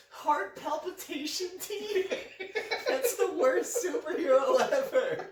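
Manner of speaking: high, loud, cracking up; laughing disbelief. Real, not acted. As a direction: squeal it through laughter. Mocking mispronunciation while laughing.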